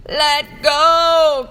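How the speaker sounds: high, loud desperate cry; cry of pain. Acted. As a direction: yell to be let go. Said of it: "cried the elephant's child".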